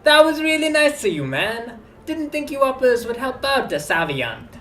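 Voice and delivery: put-on high, slow street-kid voice; young man character voice.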